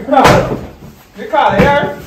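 loud, high-pitched call by name. Acted. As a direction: shout for someone to come over. Calling out to Ricardo.

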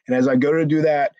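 ordinary talk, nothing marked

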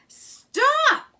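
sudden high shout of alarm; cry out. A narrator's reading. Rat shouting at Mole to stop rowing. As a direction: yell it sharply as the boat tips. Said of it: "cried Rat from the bottom".

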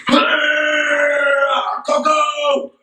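high, slow and drawn out; excited. Real, not acted. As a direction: savor the words happily. Excited over hot cocoa.

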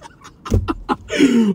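high, hammed-up gloating laugh. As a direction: laugh high at your own greatness. Hammed-up gloating at own greatness.

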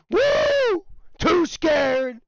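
loud, high whooping cheer; excited cheer. Real, not acted. Gamer cheering at his squad as the enemies run off, loud whoop. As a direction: celebrate at the top of your voice.

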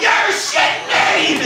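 high, drawn-out shocked yell; disbelieving guy. Acted. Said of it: "where was the guy going".

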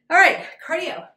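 pumped trainer call; loud rising rally cry; energetic shout. Real, not acted. Energetic switch to cardio.

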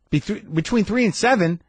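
high, disbelieving question; incredulous echo. Retold or imitated. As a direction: repeat it back in disbelief. Incredulous echo of receptionist's time window.

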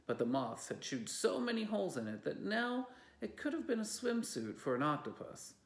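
ordinary delivery, nothing marked

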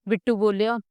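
usual speaking voice

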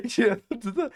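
words breaking into high laughter; laughing mid-sentence. Real, not acted. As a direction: start explaining, then crack up. Laughs while explaining.

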